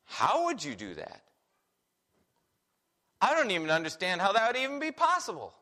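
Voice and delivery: puzzled, hurried disbelief; as if it cannot even be imagined; baffled how. Retold or imitated. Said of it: "Paul says".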